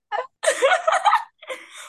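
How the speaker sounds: high loud burst of laughter; full-body laugh. Real, not acted. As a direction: laugh with your whole body. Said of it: "Full body".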